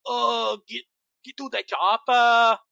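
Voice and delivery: loud, slow mock Arnold Schwarzenegger shout; mocking bad Arnold. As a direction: ham up a terrible Austrian action-hero bark. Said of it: "But yours is like".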